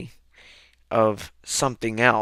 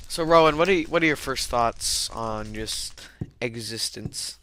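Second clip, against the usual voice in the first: ordinary talk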